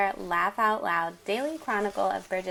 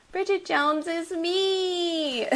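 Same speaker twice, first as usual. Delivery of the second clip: slow, high hammed-up fan cry; playful mock exclamation; mock shout; 8 semitones higher, slower than usual. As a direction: exclaim it like a gushing reader. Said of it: "you'll find yourself shouting".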